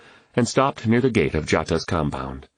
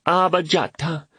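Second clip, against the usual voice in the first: put-on high, excited child's call; children's cheer; 12 semitones higher than usual. A narrator's reading.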